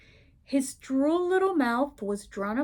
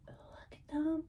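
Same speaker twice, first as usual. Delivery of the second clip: softer higher whisper; whispered aside; 5 semitones higher, 11 dB quieter than usual. Real, not acted. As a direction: lean in and whisper it.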